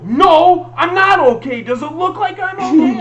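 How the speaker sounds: loud, high snapping yell; angry yell. Retold or imitated. Snapping at Heather who asked if he's okay, yelling. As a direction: bite her head off. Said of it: "I went the fuck off".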